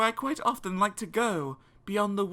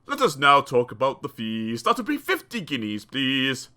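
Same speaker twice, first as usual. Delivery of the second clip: dentist exclaiming; 8 semitones lower than usual. Acted. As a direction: lower smug fee demand. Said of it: "the man exclaim".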